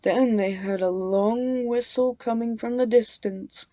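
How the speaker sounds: ordinary delivery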